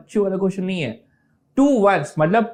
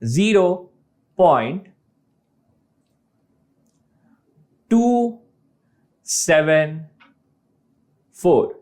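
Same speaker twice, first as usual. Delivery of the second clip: "Zero point टू seven four" spoken digit by digit with long pauses; slowly name each digit, "टू", "seven", "four", one at a time; right reading; slower than usual. Shown as a demonstration. Teacher showing students the right way, slowly digit by digit. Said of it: "इसे या तो आप लोग ऐसे पढ़ सकते हो".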